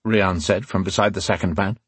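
ordinary delivery

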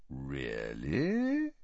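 low, soft question with slow rise; Snape, quiet. A narrator's reading. Suspicious teacher answers the merry professor, very quietly. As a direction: drop low and quiet, suspicion creeping upward. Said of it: "said Snape quietly".

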